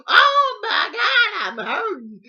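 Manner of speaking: very loud, high, drawn-out wail; imitated shriek of pain; mock screaming. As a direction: holler it like a hurt sister, hammed up. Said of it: "Screaming and hollering".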